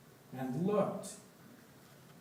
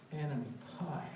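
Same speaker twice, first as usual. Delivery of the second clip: hushed reveal; 8 dB quieter than usual. A narrator's reading.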